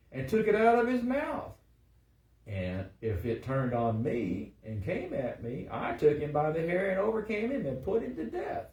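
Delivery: high, loud, rousing declamation; dramatic scripture reading. A narrator's reading. David recounts his fights to Saul, rousing scripture reading. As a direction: proclaim it, climbing to forceful peaks. Reads David's boast at full force.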